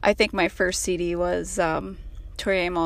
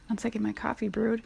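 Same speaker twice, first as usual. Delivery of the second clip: ordinary talk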